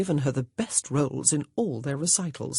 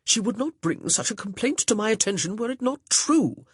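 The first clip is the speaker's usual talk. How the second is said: stern, higher-pitched gentleman's voice; 6 semitones higher than usual. A narrator's reading. Augustin defending his fiancée to Honor, stern. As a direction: lay down the law, a little pompous. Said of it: "said sternly".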